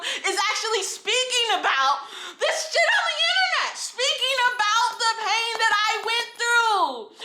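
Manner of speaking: high, impassioned real rant; angry yelling. Real, not acted. Survivor speaking out online about her pain, yelling. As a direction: yell it out with fierce conviction. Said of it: "I've been yelling".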